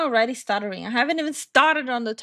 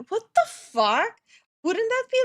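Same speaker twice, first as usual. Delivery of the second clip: shocked disbelief; 11 semitones higher than usual. Real, not acted. Streamer hearing about the vomiting kid, shocked. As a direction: balk at the story. Reacting to vomiting child story.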